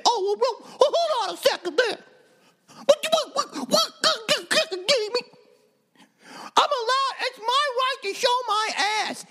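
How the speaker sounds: very high, stammering mock-offended woman, ending in a fake wail; mock protester. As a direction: squeal and sputter like an outraged Instagram poster. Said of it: "Now you're gonna say".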